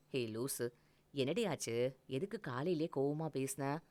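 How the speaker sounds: put-on man gently asking his upset girlfriend why; tender teasing. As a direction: cradle her with the words. Hugging her, gentle tease.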